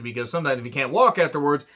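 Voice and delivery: ordinary delivery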